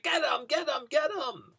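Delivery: retold yell. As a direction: yell it like a desperate man chasing thieves. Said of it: "So now he's yelling at me".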